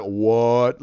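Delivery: loud, shocked. Retold or imitated. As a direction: blurt it in shock. Audience shock.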